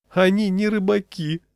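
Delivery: high-pitched, tormented exclamation; anguished cry. A narrator's reading. Peddler to refusing customers, anguished outcry. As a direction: cry out in wounded disbelief. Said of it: "с мукой в голосе выкрикнул".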